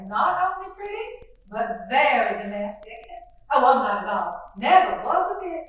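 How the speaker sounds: gushing genteel belle, chattering; lightly voiced Southern mother greeting the guest; fluttery Southern mother. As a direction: play the chatty Southern hostess.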